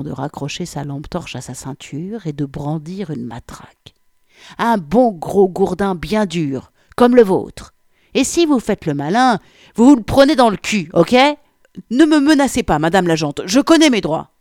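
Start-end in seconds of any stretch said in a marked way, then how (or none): 4.6-11.2 loud, high-pitched menace from a policewoman; threatening. A narrator's reading. Policewoman threatens him with her truncheon. 11.9-14.3 loud, raised, defensive outburst; protesting. A narrator's reading. He protests against the threat.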